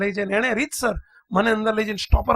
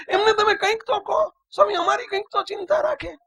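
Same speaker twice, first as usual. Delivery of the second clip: high, loud, tearful complaint; imitating a wailing child; 7 semitones higher, 3 dB louder than usual. A monk voices the boy crying about his quarrelling parents. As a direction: cry out the father's fighting like a hurt boy. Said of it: "એ બાળક કલ્પાંત કરતું જાય, રડતું જાય".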